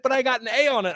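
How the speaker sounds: high mock child voice; proud kid protest. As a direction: whine it like a kid defending his grade. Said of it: "and he's like".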